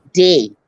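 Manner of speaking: belching out the letter; burped letter. Real, not acted. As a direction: burp the letter. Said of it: "I'm burping the alphabet by request".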